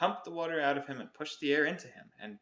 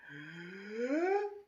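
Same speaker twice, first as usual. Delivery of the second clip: gasp; 9 semitones higher than usual. Acted. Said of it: "he panted".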